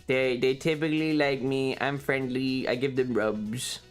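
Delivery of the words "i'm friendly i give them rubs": ordinary talk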